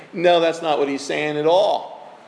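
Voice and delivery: normal talking voice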